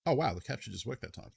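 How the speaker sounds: ordinary talk, nothing marked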